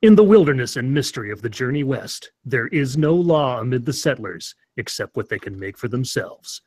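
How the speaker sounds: dramatic period-ad narrator; deadpan Old West cop-show announcer; mock ad read. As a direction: read it like a solemn frontier trailer, in measured phrases.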